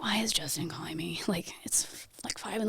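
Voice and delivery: groggy half-whisper; sleepy whispered confusion. Retold or imitated. Groggy phone call at 5am.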